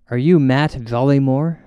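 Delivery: usual speaking voice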